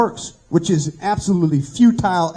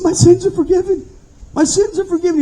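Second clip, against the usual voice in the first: high-pitched tearful joy, acted out; imitated crying out; 10 semitones higher than usual. Storyteller voicing a forgiven man, imitated crying out. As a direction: cry it out like a man overwhelmed by relief. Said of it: "He's crying out with tears".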